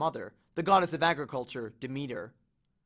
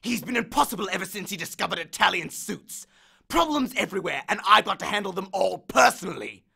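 louder and higher, building to a roar; ranting god; 4 semitones higher, 7 dB louder than usual. Acted. Hades rants to Percy, building to a roar. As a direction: let the grievance swell.

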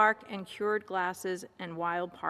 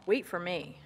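plain reading voice

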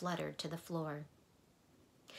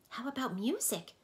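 eager, bright question, pitched up; excited question; 7 semitones higher, 3 dB louder than usual. A narrator's reading. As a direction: ask it like a kid who can't wait. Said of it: "asked Milo excitedly".

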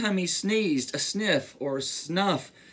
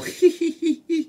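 high acted giggle; high-pitched storybook tittering; 13 semitones higher, 4 dB louder than usual. A narrator's reading. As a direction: giggle as a tickled elephant. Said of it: "He began to giggle".

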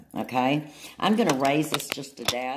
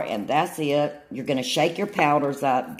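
ordinary talk, nothing marked

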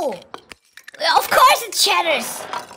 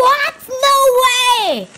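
shocked; 5 semitones higher, 5 dB louder, slower than usual. Real, not acted. Shocked reaction to the video.

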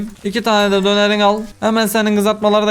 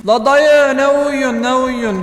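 high, drawn-out scolding holler; exasperated calling out; 5 semitones higher, slower than usual. Real, not acted. Player hollering at the sleeping worker, exasperated calling out. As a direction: stretch out the high-pitched complaint.

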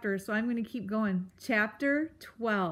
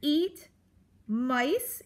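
raised, incredulous question; exclaiming; 4 semitones higher than usual. A narrator's reading. Porcupine shocked by the accusation, exclaiming. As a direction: exclaim in disbelief, rising at the end. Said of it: "Aerith exclaimed".

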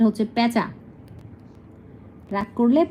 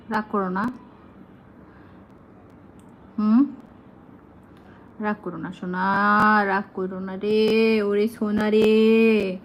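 soothing coax; slower than usual. Real, not acted. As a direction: coax it gently out of its sulk. Soothing sulking bird.

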